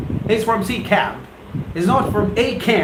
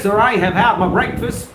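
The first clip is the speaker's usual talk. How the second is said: ordinary talk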